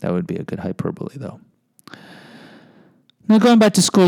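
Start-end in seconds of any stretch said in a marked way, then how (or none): 3.3-4.1 high, louder transition; an emphatic bright segue; loud restart. Real, not acted. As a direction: jump in loudly to change topic. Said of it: "let's finish with the lady here".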